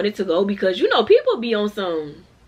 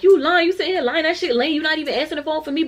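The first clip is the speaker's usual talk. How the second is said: put-on high-pitched angry rant at the lying boyfriend; going off again; 6 semitones higher, faster than usual. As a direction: lay into him again, voice up high. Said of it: "So she go off again".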